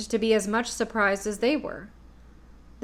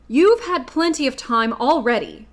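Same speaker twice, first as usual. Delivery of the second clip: a touch of anger, louder; angrily; 3 semitones higher, 7 dB louder than usual. A narrator's reading. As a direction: snap it out impatiently. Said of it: "said the Tin Woodman angrily".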